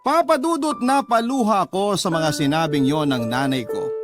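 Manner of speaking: ordinary talk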